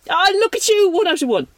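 high, bright bursts of praise; delighted praise. Real, not acted. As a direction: cheer her on. Host praising quiz answer.

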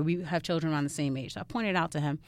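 usual speaking voice

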